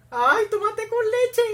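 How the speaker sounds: high, loud, drawn-out cry; piercing excited exclamation; squealed outburst. Real, not acted. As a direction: squeal it out slowly and loudly. Outburst at the film scene.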